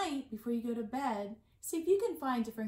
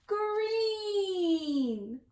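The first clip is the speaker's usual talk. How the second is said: higher, drawn-out exclaimed reveal; excited reveal; 6 semitones higher than usual. Acted. Librarian revealing the colour to young listeners, excited. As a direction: announce the colour with glee.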